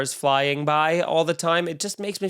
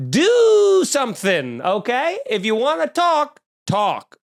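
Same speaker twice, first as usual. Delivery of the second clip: loud slow demand; angry shout; 8 semitones higher, 4 dB louder, slower than usual. Real, not acted. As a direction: yell it, slow and hard. Rant at North Korea.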